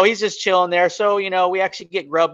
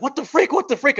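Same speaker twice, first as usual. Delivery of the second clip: high-pitched alarmed repetition; mock panic; 10 semitones higher than usual. Scared friend hearing things running, high panicky voice. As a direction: imitate a friend freaking out.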